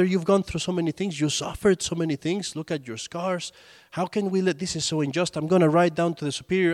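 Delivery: high, agitated protest; complaining. Retold or imitated. Brother protesting to John of the Cross, high and agitated. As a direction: plead the injustice, pitched high and upset. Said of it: "starts complaining".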